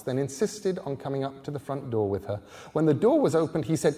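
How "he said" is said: ordinary delivery, nothing marked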